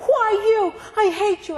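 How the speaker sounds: imitating a crying boy's angry wail. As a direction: wail it like a hurt boy in tears. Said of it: "he was crying".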